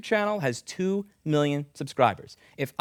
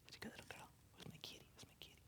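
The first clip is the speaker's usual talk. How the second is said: soft whispered praise; hushed pet whisper; whispering to a cat; 30 dB quieter, much faster than usual. Real, not acted. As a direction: whisper sweetly to the kitty.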